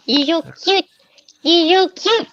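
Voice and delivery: high cooing pet voice. Real, not acted. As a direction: coo up high at the puppy. Talking to the dog Evie.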